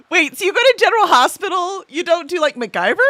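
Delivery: high, loud incredulous question; can't believe what she's hearing; amused disbelief. Real, not acted. Streamer reacts to a viewer's TV choice, incredulous.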